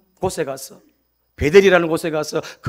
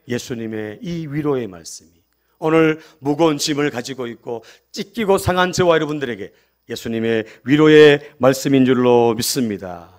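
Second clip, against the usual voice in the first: normal talking voice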